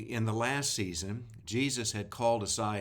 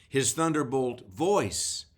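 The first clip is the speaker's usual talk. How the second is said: slow, with voice pushed louder and higher; stress on voice; 4 semitones higher, 5 dB louder, slower than usual. Acted. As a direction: lean hard on voice, slowly. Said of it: "I was emphasizing, the word voice".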